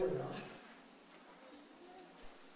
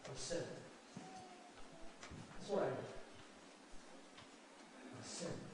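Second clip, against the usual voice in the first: quiet admission; 13 dB quieter, much faster than usual. Real, not acted. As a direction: admit it under your breath. Pastor's quiet admission of sin.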